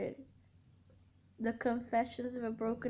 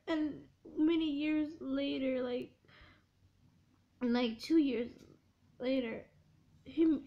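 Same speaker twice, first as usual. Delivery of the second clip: ordinary talk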